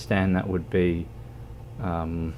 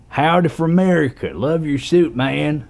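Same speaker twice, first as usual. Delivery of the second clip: high, loud, hammed-up American accent; put-on American accent; 8 semitones higher, 7 dB louder than usual. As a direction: greet like an exaggerated American. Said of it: "my American compadre".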